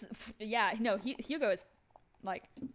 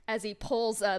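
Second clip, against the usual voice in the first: ordinary talk, nothing marked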